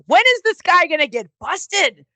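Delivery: high-pitched, retold incredulous question; retold exclamation. Wrestler wondering about the cheating regular, retold high exclamation. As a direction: recount it as a high, animated outburst.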